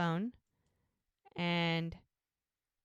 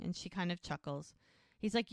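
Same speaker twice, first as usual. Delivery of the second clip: ordinary delivery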